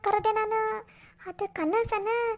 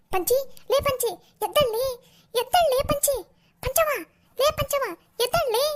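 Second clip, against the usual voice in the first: high, insistent wake-up calls; calling to wake; 6 semitones higher than usual. Acted. Woman rouses her sleeping neighbour, calling insistently. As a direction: call the name sharply to rouse a sleeper.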